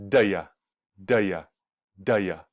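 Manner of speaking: "da ya" as two light quick syllables for do you; reduced da ya. Shown as a demonstration. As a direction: repeat "da ya" like a drill. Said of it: "you hear a da ya".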